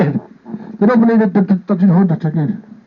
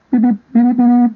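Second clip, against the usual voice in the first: raised, choppy mock beeps; Morse code beeps; 5 semitones higher than usual. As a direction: tap out Morse code with your voice. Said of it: "My ear started to do Morse code".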